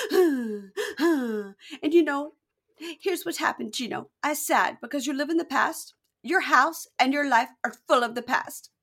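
high, gasping mock lament; tearful girlfriend. Girlfriend blaming boyfriend for the past, tearful. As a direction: plead through put-on tears. Upset girlfriend explaining after gasps.

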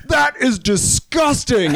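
slow, high mock-heroic shout; superhero indignation. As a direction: proclaim it slowly, chest puffed out. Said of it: "puffs his chest out like a superhero".

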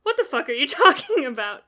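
loud, laughing incredulity; mock bafflement. Guilty Spark retold as baffled by talk of gods, mock bafflement. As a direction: play up total bafflement, a laugh breaking in. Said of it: "Guilty Spark's like".

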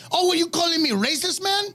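hammed-up indignant drunk; mocking drunk guy. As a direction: squeak it out like a defensive drunk. Mocking drunk driver's reply.

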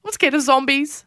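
high-pitched imitation of a scared kid; little child's voice. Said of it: "I hear a little voice go".

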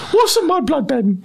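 high, slow comic voice; put-on silly character. Joker replying as the insulted wizard, silly high voice. Answering as the teased Dimbleby.

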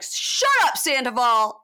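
high, loud retort, imitating the group; mock outraged shout. Retelling the whole cast shutting Sandoval up, mock outraged. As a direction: playfully yell them down.

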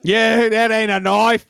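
slow, broad Australian movie parody; drawn-out comic Australian boast; Australian accent. Acted. Co-host answering a viewer's knife quote, broad Australian accent. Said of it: "Can you do an Australian accent?".

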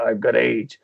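imitazione di Charlie Sheen con voce molto più bassa; Charlie Sheen impression. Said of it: "speaks a bit like this".

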